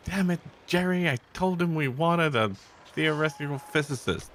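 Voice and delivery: high, exasperated dad complaining; frustrated parent voice. Retold or imitated. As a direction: play a fed-up parent. Voicing a disappointed parent.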